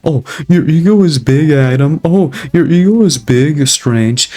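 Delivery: put-on, drawn-out taunting quote; mocking imitation. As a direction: parrot a critic in a mocking high voice. Mimicking people who accuse his ego.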